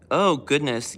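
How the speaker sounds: ordinary talk, nothing marked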